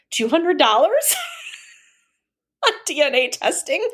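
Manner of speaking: retold complaint breaking into high-pitched laughter; laughing mock outrage. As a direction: let the mock indignation crack up into laughs. Laughing at own retold rant.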